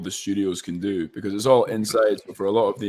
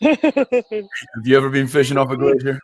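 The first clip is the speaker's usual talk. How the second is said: put-on louder, higher Southern-accented line delivery; acted Southern accent, pitched up; 5 semitones higher, 6 dB louder than usual. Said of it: "great job on your Southern accent".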